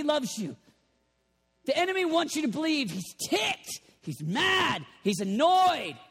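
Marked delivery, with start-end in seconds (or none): none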